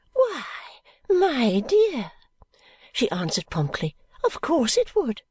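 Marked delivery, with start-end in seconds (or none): 0.1-2.1 put-on high, fluttery old lady, whispering bits; a rambling old woman clinging to hope; old Miss Flite. 4.2-5.2 put-on high, fluttery old lady, whispering bits; a rambling old woman clinging to hope; old Miss Flite.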